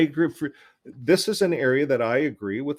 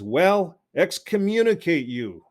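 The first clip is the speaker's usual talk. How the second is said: higher, louder mock pronouncement; 7 semitones higher, 5 dB louder, slower than usual. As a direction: make fun of it, pitched up and louder. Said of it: "So he's making fun".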